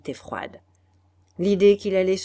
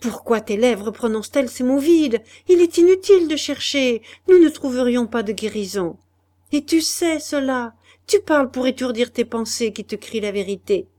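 high, loud, bitter rebuke; Madeleine scornful; 5 semitones higher, 8 dB louder than usual. Acted. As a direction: strip away his empty words. Madeleine's retort. (reproche amer, voix haute et forte)

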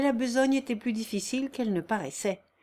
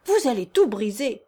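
louder exasperated warning; crying out; 2 semitones higher, 6 dB louder than usual. A narrator's reading. Master fearing his machine will break, crying out. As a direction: warn him off in a raised voice. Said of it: "cria monsieur Cardonnet".

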